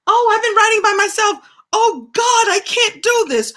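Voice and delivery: high, loud and fast, losing nerve; sudden fright as she realizes; panicking child. Retold or imitated. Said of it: "I was like".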